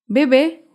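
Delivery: "Bebé" as be-BÉ, rising onto the final syllable; careful teaching demo of "Bebé", weight on the end; stress on last syllable. Shown as a demonstration. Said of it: "Se acentúa en la última sílaba".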